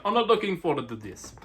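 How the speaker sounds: a bad imitation of a Spanish accent, leaning Italian; hammed-up Spanish accent, lightly done; mock Spanish accent. Rider Prado voiced as dreading the race, in a mock Spanish accent. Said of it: "or in his Spanish accent".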